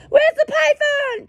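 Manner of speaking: high, slow squeal of fake panic; fussing mother shrieking; joking made-up warning; mock alarmed mother. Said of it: "she didn't say that either".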